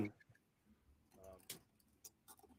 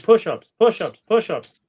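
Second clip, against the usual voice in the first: high, loud repeated call; egging the dogs on, high and loud; playful rapid-fire command; 9 semitones higher, 10 dB louder than usual. Pretend. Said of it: "I'll just be like".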